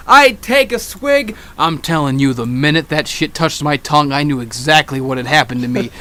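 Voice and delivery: ordinary talk, nothing marked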